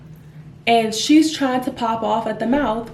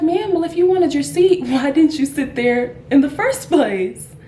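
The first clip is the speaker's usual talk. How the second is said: exasperated challenge; 6 semitones higher, 4 dB louder than usual. Retold or imitated. Retelling her exasperated challenge to passenger.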